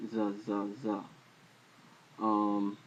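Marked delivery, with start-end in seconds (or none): 0.1-0.9 "Z, Z, Z" as soft buzzed "zuh" syllables; Z sound. Shown as a demonstration. Teacher demonstrating Zion's sound, "Z" said as soft "zuh". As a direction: sound out "Z" as "zuh" three times, softer. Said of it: "Zion is a Z sound".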